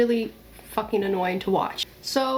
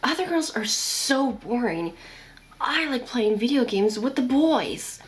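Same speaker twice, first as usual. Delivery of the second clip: put-on cartoon voice for a pick-me girl; hammed-up girl showing off for the boys; Deku; 3 semitones higher than usual. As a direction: imitate a boastful pick-me, cartoon style. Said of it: "doing a Deku voice when I'm, like, imitating these girls".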